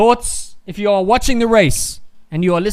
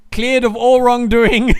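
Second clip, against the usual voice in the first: laughing through the words, louder; 6 semitones higher, 5 dB louder than usual. Real, not acted. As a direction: crack up while correcting himself. Laughs at his own wrong call.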